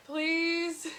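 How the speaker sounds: loud, high desperate please; begging plea. Retold or imitated. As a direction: beg for relief, high and loud. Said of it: "I was like".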